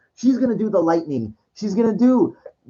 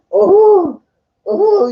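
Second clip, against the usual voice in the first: much higher, louder startled cries; high mock villain yelp; 13 semitones higher, 6 dB louder than usual. Mocking Palpatine losing control, high loud yelps. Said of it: "he's like".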